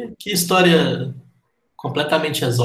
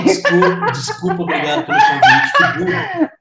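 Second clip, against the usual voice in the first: high-pitched laughter through the words; laughing at himself; 5 dB louder than usual. Real, not acted. Host laughing at his own mistake, laughing through it. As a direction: laugh at your own slip while correcting it.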